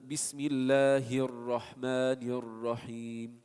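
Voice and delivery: Bismillahir Rahmanir Rahim recited slowly, ra lightly rolled; full Quranic recitation voice. Shown as a demonstration. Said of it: "the rolling, it enables you to continue".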